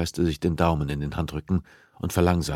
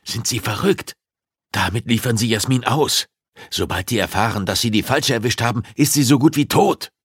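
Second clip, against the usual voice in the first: higher and louder, building to a cry; he sees his daughter dead; frightened outburst; 6 semitones higher, 6 dB louder than usual. Acted. Pulaski fears for daughter.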